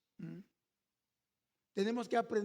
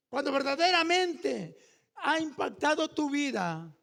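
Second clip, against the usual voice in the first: preaching; 3 semitones higher, 3 dB louder than usual. Acted. Preaching with rising force.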